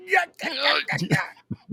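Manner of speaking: quick high yeahs. Retold or imitated. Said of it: "he's like".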